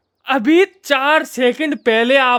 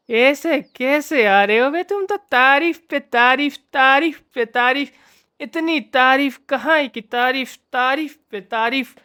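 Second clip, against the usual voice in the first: lightly put-on voice, pitched higher; hammed-up film-style protest, hammering the repeated words; mock dramatic tirade; 3 semitones higher than usual. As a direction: play at being theatrically exasperated.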